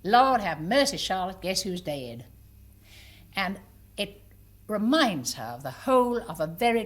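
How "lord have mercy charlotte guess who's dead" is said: put-on Virginia old lady. Old lady to friend over newspaper, Virginia accent. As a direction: gossip in an old Virginia lady's Southern voice. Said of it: "my mother's Virginia voice".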